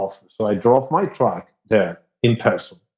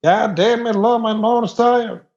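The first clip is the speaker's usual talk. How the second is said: put-on Texas accent; 9 semitones higher than usual. Performing Texan clichés for the host, high Texas accent. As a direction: play the proud Texan, pitched well up. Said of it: "do your Texas accent".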